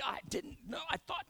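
barely voiced, stammering whisper; flustered whisper. Driver yelled at in parking lot, stammering whisper. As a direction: caught off guard, gasp it out under your breath.

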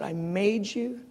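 soft, tender answer fading away. Retold or imitated.

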